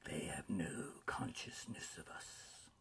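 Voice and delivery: low, soft whisper; hushed spirit. A narrator's reading. Ghost saying the travelers cannot see them, low hush.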